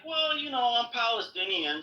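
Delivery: lightly voiced inspector, shrugging; casual cop. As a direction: toss it off like it's nothing. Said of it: "He's like".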